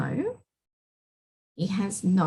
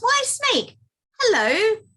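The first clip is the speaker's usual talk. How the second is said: high, loud little mouse; cheery mouse greeting; 12 semitones higher, 5 dB louder than usual. Acted. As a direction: call hello as a tiny mouse.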